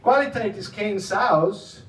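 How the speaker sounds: slow, careful Dutch phrase. Retold or imitated. Quoting a Dutch saying heard at work, slow Dutch. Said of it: "If somebody says something like".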